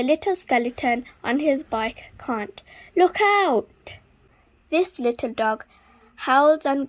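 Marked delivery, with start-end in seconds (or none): 2.9-4.0 loud, high warning cry; warning shout. Acted. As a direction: shout to stop the crash. Warning before crash.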